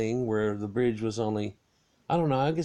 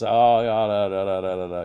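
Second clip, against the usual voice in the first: loud strange da-da chant imitating the light; mock spooky humming; 7 dB louder than usual. Imitating the ghost light to his listeners, loud nonsense syllables. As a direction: belt out nonsense syllables as the eerie thing. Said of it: "And it goes".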